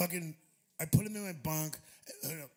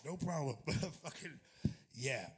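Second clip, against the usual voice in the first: ordinary talk, nothing marked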